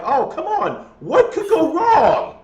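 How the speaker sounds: high, slow, drawn-out sarcastic jinx; tempting fate with a slow mocking sneer; mock sarcasm. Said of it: "I'm like".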